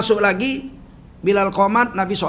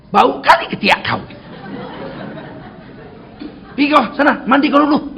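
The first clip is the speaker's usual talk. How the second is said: higher and louder, bluntly scolding a smelly friend; blunt loud Medan rebuke; 7 semitones higher, 4 dB louder than usual. Pretend. Preacher voicing a blunt Sumatran telling a friend he stinks, loud and blunt. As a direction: bark it straight out, no softening.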